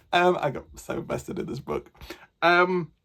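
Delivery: normal talking voice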